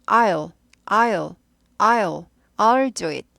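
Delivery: "I'll" said as a single clipped syllable "al"; contracted I'll. Shown as a demonstration. Teacher drilling the contraction "I'll" for learners. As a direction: squeeze "I'll" into one short sound. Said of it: "줄여서 I'll".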